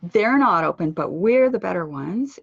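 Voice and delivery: THEY'RE and WE'RE hit loud; bragging contrast. Shown as a demonstration. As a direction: brag by pounding THEY'RE and WE'RE. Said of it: "sound like you're bragging".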